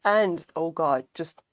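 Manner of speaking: ordinary talk, nothing marked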